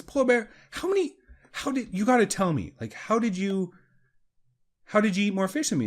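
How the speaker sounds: high, eager monkey asking; curious monkey. Retold or imitated. The beaten monkey begs the polar bear, high and eager. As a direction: pitch up and plead for the secret.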